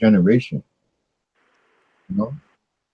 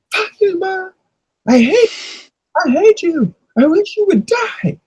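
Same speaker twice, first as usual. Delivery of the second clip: much higher mock bratty kid voice; bratty kid tantrum; slower than usual. Edomite kid telling his mom he hates her, in a high mock bratty voice. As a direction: play the spoiled kid screaming at his mom, pitched way up.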